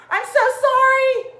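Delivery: high and loud, flustered sorry; flustered apology. Real, not acted. Instructor apologizing to her class after a mishap, flustered.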